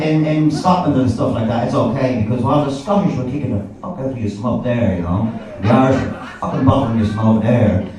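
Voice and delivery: normal talking voice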